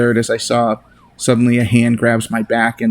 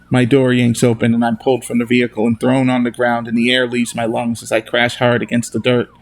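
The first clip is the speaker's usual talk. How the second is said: usual speaking voice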